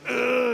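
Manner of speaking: mock groan of agony; agonized groan. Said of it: "I'm in agony here".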